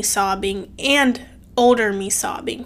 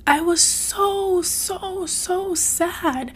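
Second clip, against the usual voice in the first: higher, slow and breaking; tearful; 7 semitones higher, 4 dB quieter, much slower than usual. Real, not acted. Telling viewers about her younger self, tearful. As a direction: voice cracking with real sadness. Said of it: "I'm getting a little emotional".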